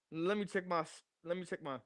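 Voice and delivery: usual speaking voice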